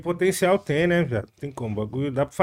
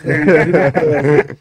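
loud laugh; 10 dB louder than usual. Real, not acted. Reacts to news of [name] beats.

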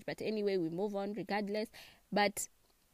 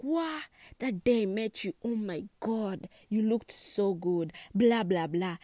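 louder, gushing mock compliment; over-the-top gushing; 5 dB louder than usual. Shown as a demonstration. Overdone compliment to partner's friend, gushing and loud. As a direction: pile it on like a gushing fan. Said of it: "overdo it and be like".

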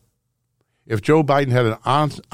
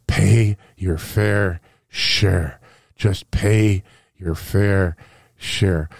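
slow, drawn-out mock whisper of an old politician; mock Biden whisper; 5 semitones lower, much slower than usual. Radio host mocking Joe Biden at the microphone, slow drawn-out mock whisper. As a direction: stretch each word out slowly, mocking a hushed plea. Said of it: "he whispers. He says".

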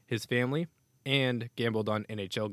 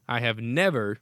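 NEVER hit louder with a falling tune; never in all caps; 6 dB louder than usual. Shown as a demonstration. Host reading the statement, stressing never as printed in caps. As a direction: punch never as if in capitals. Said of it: "in all caps".

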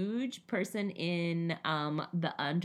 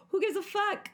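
put-on much higher, tough-girl shrug; brushing the pain off; mock defiant; 14 semitones higher than usual. Retelling cutting her finger.

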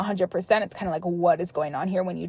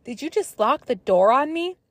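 higher retold shout; mock yell; 6 semitones higher than usual. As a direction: call it through the door. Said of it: "I hear a female yell".

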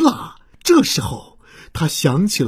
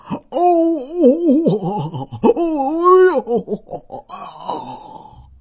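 groaning dinosaur moans, rising then fading; pained groans; 6 semitones higher, much slower than usual. Acted. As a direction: moan in pain, then let it die away. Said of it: "疼得嗷嗷地叫". (痛苦的呻吟)

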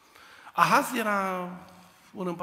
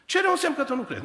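demanding God; 9 semitones higher, 6 dB louder, faster than usual. Acted.

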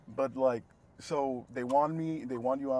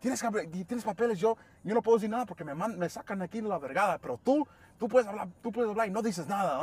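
imitating an upset coworker, higher-pitched; 7 semitones higher, faster than usual. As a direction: rattle off a mildly heated complaint. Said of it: "she got upset about that".

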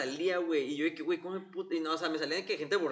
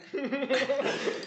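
burst of laughter; 9 semitones higher than usual. Real, not acted.

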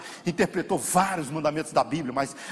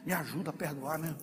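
reluctant prayer; 8 dB quieter than usual. Retold or imitated. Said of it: "Aí você vai orar".

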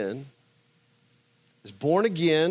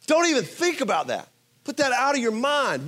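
a stern Paul, pitched up and firm; mock stern rebuke; 6 semitones higher than usual. Preacher voicing Paul's rebuke, stern. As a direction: scold the congregation as Paul.

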